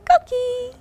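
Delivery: high chirpy frog call; coqui frog call. Acted. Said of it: "they sing softly".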